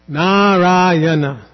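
Narayana very slow, long Na and ra, short ya-na; four syllables, long vowels. Shown as a demonstration. Teacher demonstrating diacritic stress, drawn-out four-syllable Narayana. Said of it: "Four syllables".

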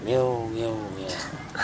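low, soft plaintive meow. Acted. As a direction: meow low and small, fading. Cat answers the sage.

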